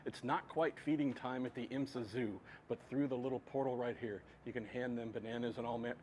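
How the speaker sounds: hushed wildlife film narrator; softly, like narrating animals in the wild; nature documentary. Pretend. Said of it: "okay, we're just kidding".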